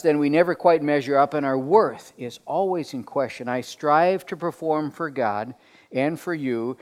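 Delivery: ordinary talk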